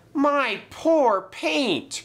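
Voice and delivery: high, slow wail of dismay; sad cry. A narrator's reading. James lamenting his paint after the crash, crying sadly. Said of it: "cried James sadly".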